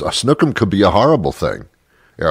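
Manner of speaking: usual speaking voice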